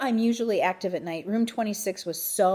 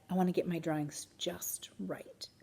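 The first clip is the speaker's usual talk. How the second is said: whispered; 8 dB quieter than usual. A narrator's reading. Joey pleading softly.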